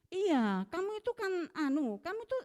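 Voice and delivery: imitating the accuser scolding her; retold finger-pointing scolding. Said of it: "diduting-duting gitu".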